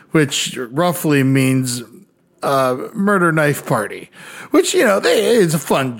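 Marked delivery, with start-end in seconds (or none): none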